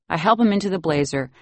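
ordinary delivery

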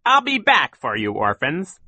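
high, loud villainous threat; calling out. A narrator's reading. Count Olaf calls to the orphans while escaping, high and threatening. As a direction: call back tauntingly as you flee. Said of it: "he called".

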